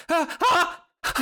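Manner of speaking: high, sudden gasping cries; spring awake with sharp gasps; startled waking gasps. Acted. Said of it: "Her eyes fly open".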